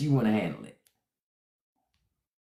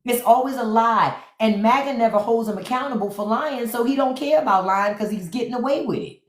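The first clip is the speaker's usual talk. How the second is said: usual speaking voice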